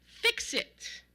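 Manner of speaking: high, exasperated command; short high-pitched outburst; exasperated shout. A narrator's reading. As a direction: shout the order, fed up. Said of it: "shouted in an exasperated voice".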